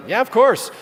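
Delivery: high, loud, cheerful agreement; tossed-off happy yes with no idea; dad cheerfully granting candy, high and loud; breezy yes. Retold or imitated. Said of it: "And I'm like".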